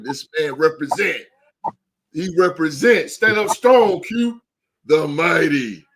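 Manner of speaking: high, punchy ring-announcer introduction; hype announcer. Acted. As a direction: herald a legend, with dramatic pauses before each title. Host announces second co-host grandly.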